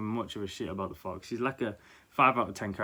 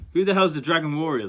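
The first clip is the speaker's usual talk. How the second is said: loud, high, incredulous mock shout; baffled retort; 5 semitones higher, 8 dB louder than usual. Townsfolk mocking the hero, loud and incredulous. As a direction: townsfolk who never heard of him. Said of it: "the folks there are like".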